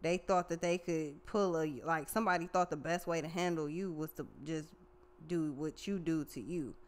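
normal talking voice

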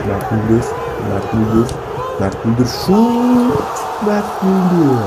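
goal scream, high and very loud; screaming football commentator. Acted. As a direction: scream the goal call, stretching it out. Goal call, rising to a scream.

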